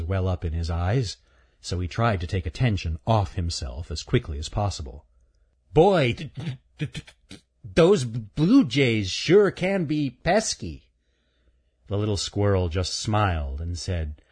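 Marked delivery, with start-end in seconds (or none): 5.7-10.9 put-on high, slow boy's voice stuck on d-; stuttering. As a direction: stammer through it, covering his embarrassment. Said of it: "Stuttering Stan".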